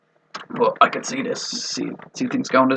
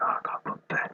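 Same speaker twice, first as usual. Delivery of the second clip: mock sleepy whisper; 9 dB quieter than usual.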